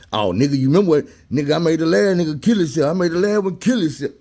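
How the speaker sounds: high, boastful mock of a bragging con; bragging inmate. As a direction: crow about it like a proud fool. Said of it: "They were bragging about this shit".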